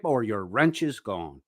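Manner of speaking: usual speaking voice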